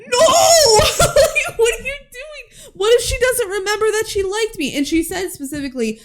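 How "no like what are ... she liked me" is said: much higher, louder shouted protest broken by laughter; laughing outraged yell. Retold or imitated. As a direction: yell in mock outrage while cracking up. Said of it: "And Scott's like".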